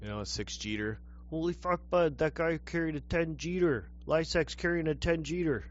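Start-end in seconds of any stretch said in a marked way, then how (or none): 1.3-5.7 loud, high, amazed exclamation; excited shout. Real, not acted. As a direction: shout it out in disbelief. Excited outburst about lift.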